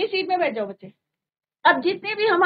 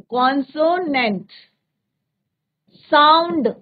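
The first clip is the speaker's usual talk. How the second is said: Consonant slowed, then sound punched louder; stressed phrase; 4 dB louder, slower than usual. Shown as a demonstration. Teacher insists on sound, not letter, punching sound. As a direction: stretch consonant and hit sound hard. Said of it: "मैं ये नहीं कह रही कि".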